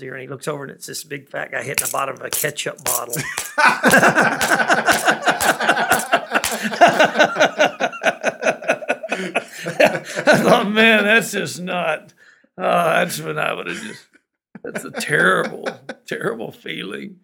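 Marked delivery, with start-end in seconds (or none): 3.2-9.0 loud, high burst of laughter; big laugh. Real, not acted. Laughing at ketchup bottle punchline. 9.0-17.2 laughing while quoting. Retold or imitated. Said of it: "That's lonesome".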